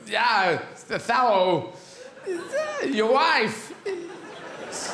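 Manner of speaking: a hint of Walken's halting New York drawl; loosely voiced Walken as Iago, choppy phrasing; lightly voiced Christopher Walken Iago; bad Christopher Walken. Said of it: "This is Christopher Walken".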